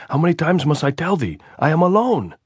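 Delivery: high, loud, fed-up yell; exasperated shout. A narrator's reading. Andres fed up with repeating himself, shouting. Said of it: "Andres shouted".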